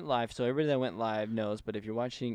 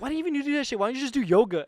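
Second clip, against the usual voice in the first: mom scolding; 13 semitones higher, 6 dB louder, faster than usual. Retold or imitated. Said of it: "And she was like".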